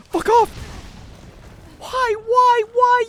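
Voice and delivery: high, loud, despairing why; anguished yelling. Real, not acted. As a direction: wail at the screen. Screaming at game.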